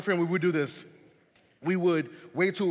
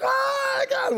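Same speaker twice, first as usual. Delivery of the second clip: much higher, louder terrified scream; screaming in fright; 6 dB louder, slower than usual. Retold or imitated. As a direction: shriek it like the movie just got you. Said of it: "We're in the movie theater screaming".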